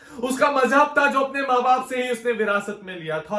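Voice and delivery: loud, high-pitched declamation, sustained; impassioned protest. Acted. Poet protesting blood spilt over inherited religion, impassioned outcry. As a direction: cry out the verse against the injustice. Poem protests a child's killing.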